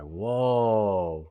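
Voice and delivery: long drawn-out whoa; stunned reaction. Retold or imitated. Storyteller reacting to a friend's slur, stunned. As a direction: draw the word out in amazement.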